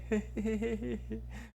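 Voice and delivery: a soft, high giggle, mimicking a stoned guy; imitated stoned laugh. Friend imitating a high guy's laugh, soft giggle. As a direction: giggle like someone who is high. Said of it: "aí o outro cara ri assim".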